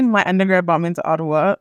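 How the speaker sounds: ordinary talk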